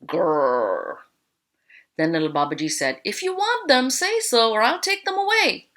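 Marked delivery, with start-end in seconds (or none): 0.0-1.0 low rolling "grrr"; tiger growl. A narrator's reading. Tigers answer little Babaji, growling. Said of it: "the tigers only answered". 3.0-5.7 high-pitched storybook call. A narrator's reading. Little Babaji warns the tigers about their clothes, calling out.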